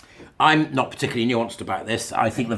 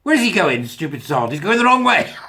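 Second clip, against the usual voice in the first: higher and louder, mocking the lone pig; mocking crowd; 8 semitones higher, 8 dB louder than usual. The herd jeering at the pig going left, loud and high. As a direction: jeer at the stray one. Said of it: "you'd look at the pig and go".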